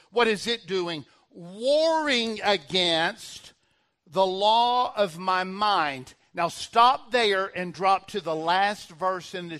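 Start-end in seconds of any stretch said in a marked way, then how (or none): 1.3-6.1 loud, very drawn-out preacher's reading; emphatic scripture reading. Real, not acted. Preacher reading the verse to the church, slow and forceful. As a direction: stretch every word of the verse forcefully. Reads scripture aloud to congregation.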